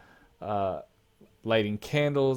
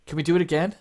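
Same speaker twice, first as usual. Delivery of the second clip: put-on much higher, louder eager child; boy asks the warrior for more, high and eager; excited boy; 6 semitones higher, 5 dB louder, much faster than usual. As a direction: beg to go again. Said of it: "and he says".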